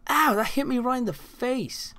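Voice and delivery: high-pitched hurt complaint; pained whine. Real, not acted. Player hit right in the face, high pained whine. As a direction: whine it high after the yelp.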